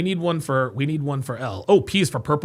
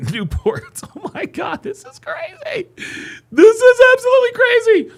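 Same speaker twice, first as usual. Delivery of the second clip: giggling disbelief. Real, not acted. Laughing at the chat's letters.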